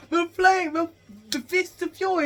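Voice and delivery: very high, loud battle cry; hammed-up heroic yell; Wade Whipple. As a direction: shout it like a cartoon attack name. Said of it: "Wade Whipple's like".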